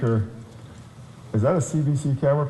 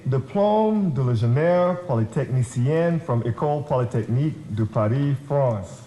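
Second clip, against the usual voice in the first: a confident French accent on the school names; French pronunciation. Real, not acted.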